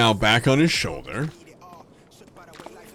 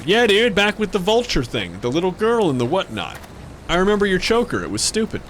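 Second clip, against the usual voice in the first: higher airhead dude voice; mock the character with a silly higher voice; mocking NPC voice; 8 semitones higher than usual. Making fun of the NPC's choker, higher mock voice.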